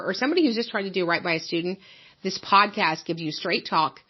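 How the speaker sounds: polished promo voice over the show opener; podcast intro read. Acted. Host pitching the podcast to listeners, upbeat promo read. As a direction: sell the show like a radio promo.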